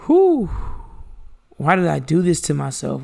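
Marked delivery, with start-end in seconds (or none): 0.1-0.5 high, loud exasperated whew; exhausted whew. Retold or imitated. Late-night binge-watcher groaning at the clock with a big high whew. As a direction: let out a big worn-out whew at dawn. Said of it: "you're just like".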